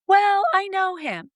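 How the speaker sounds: high, slow client voice; imitating client. Client insisting to coach, high and slow. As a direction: whine it up high. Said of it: "they're like".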